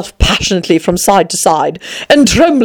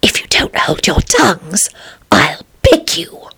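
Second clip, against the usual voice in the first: slow, hushed warning; whispered threat; slower than usual. A narrator's reading. Alice threatening the noisy daisies, whispered. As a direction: whisper it, drawing out the last words. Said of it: "she whispered".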